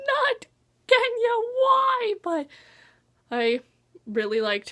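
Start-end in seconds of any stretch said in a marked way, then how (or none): 0.1-2.1 mock wail. Mock wail over lost character.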